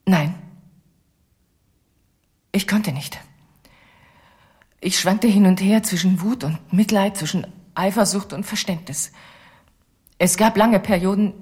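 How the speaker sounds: ordinary talk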